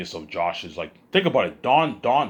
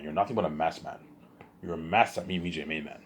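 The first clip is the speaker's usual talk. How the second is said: ordinary talk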